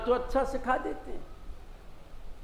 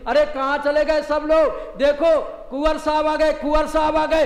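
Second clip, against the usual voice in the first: raised, higher voice calling the household; mother-in-law calling out; 6 semitones higher, 5 dB louder than usual. Retold or imitated. Mother-in-law calls everyone to greet the groom, loud and high. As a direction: call out that the son-in-law has come. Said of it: "सास जोर से चिल्ला करके बोली".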